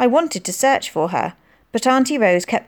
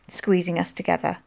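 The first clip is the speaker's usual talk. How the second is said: ordinary delivery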